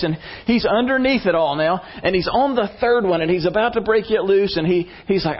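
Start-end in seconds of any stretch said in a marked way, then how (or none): none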